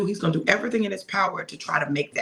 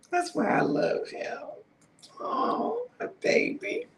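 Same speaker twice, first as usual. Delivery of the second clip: hushed, high and tender, nearly breathless; tender whisper; 4 dB quieter, slower than usual. Real, not acted. Wife cooing to her husband off camera, tender whisper. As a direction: coo it to the one you love.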